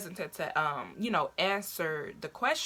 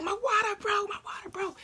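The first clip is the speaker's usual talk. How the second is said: very high-pitched mock panicked cry; retold freak-out; 13 semitones higher than usual. Telling brother her water broke, high freaked-out re-enactment. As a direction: squeal it, acting out your panic. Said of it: "I was freaking out".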